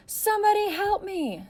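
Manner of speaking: high, slow, desperate scream; scream for help. A narrator's reading. Girl screaming for help amid the cameras, desperate. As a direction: cry out for help with all she has. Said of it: "Sarah screamed".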